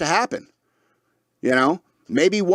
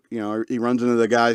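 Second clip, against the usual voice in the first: ordinary talk, nothing marked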